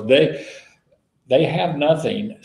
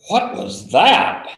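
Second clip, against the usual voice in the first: surprised; 7 semitones higher, slower than usual. Retold or imitated. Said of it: "It was like".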